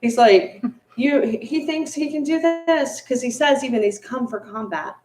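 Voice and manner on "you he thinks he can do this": slow, higher scornful bandit sneer; higher, slow mocking voice of a killer; mock scoff. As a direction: scoff at the monk's nerve, drawn out.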